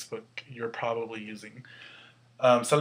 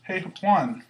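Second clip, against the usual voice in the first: higher-pitched example call; calling to someone; 6 semitones higher than usual. Shown as a demonstration. Teacher's example of hailing Juan, calling out. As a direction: call a name to get attention.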